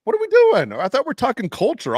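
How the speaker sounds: high, loud "what are we doing" burst of disbelief; exasperated outburst. Real, not acted. Host fed up with the depressing talk, loud exasperated burst. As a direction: throw your hands up, loud and high.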